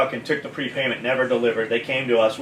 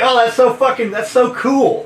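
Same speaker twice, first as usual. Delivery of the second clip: higher, louder impressed lady voice; imitated delighted woman; 8 semitones higher, 5 dB louder than usual. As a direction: put on her gushing higher voice. Imitating Chester's wife delighted.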